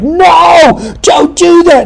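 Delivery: high-pitched mock yell of protest; exasperated viewer's cry. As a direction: cry out like warning someone on screen. Acted viewer yelling at the screen.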